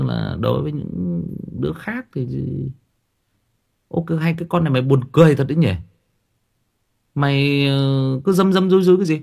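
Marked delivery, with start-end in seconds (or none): 3.9-9.2 put-on louder, higher, fast teasing jab at the girl; mocking parent. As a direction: needle the daughter about her secrets. Parent mocking a secretive daughter. (cha mẹ chế giễu con gái, giọng cao và to)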